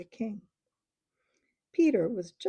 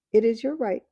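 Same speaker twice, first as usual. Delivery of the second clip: firm; 3 semitones higher, 10 dB louder than usual. Acted. Peter grants the request.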